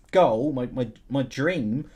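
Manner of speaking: normal talking voice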